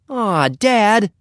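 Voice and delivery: put-on high-pitched boyish protest. A narrator's reading. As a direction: object to your father in a high whine. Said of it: "protested Henry".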